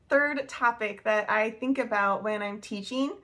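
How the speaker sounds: ordinary delivery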